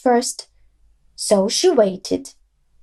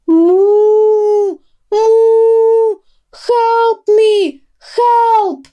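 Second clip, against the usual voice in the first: high, loud mooing for help; panicked cow cry; 9 semitones higher, 5 dB louder, much slower than usual. A narrator's reading. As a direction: call out in panic while running.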